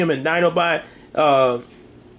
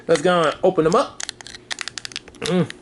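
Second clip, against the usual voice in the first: ordinary talk, nothing marked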